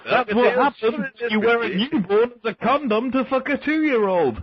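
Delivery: high, cheery DJ voice; radio DJ voice. Retold or imitated. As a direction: stay in the cheesy DJ act. Continued DJ parody.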